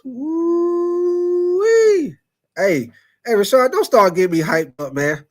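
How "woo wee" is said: high, excited woo-wee; excited whoop. Real, not acted. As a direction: let out a hyped whoop. Hyped by Luka-Joker idea.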